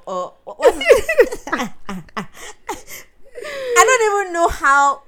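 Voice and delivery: high, loud laughing disbelief. Real, not acted. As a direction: laugh while asking.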